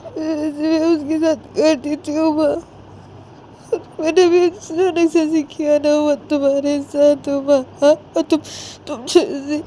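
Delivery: high, slow weepy voice; tearful Sanju confessing. Acted. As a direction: confess haltingly, dragging words through tears. (रुआँसी, धीमी और ऊँची आवाज़)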